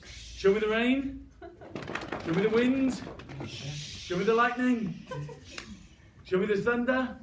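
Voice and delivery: put-on high, loud, drawn-out calls to the kids; rousing call. Storyteller rallying the children to make a storm.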